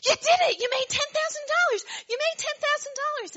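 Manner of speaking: very high, loud, overjoyed cheering; thrilled shout. Retold or imitated. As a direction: cheer her on, beside yourself. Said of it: "And John says".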